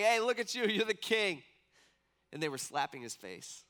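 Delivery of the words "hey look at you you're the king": higher, louder mocking jeer. Soldiers taunting beaten Jesus as king, mocking jeer. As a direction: sneer it out like a taunt. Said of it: "They begin mocking him".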